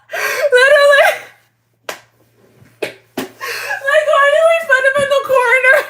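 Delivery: high, squeaky words through laughter; cracking up so hard the words barely come out; helpless laughing. Real, not acted. Streamer cracks up at viewers over the corner, helpless laughing.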